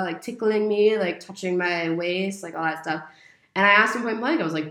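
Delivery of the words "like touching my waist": ordinary delivery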